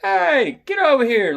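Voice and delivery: loud, high-pitched yell; retold screaming. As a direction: scream after a runaway dog. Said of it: "I'm screaming".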